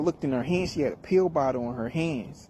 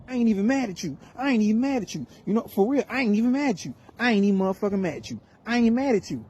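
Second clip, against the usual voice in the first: higher, mock-heated boyfriend repeating himself; imitating the boyfriend; 7 semitones higher, faster than usual. Young boyfriend calling her, higher, mock-heated insistence. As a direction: play the wound-up kid insisting he isn't mad.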